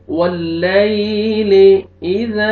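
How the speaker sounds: वल्लैली with the ले softly drawn, slowly; वल्लैली, glide the या gently; soft ya leen. Shown as a demonstration. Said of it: "नरमी से अदा करेंगे". (वल्लैली में या नरमी से)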